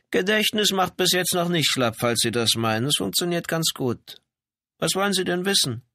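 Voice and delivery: high-pitched, self-assured wife; assured housebound wife. A narrator's reading. Janitor's wife vouches for her memory to police, assured.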